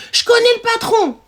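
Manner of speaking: high-pitched imitation of a client; insistent caller. Client claims to know the boss, insistent.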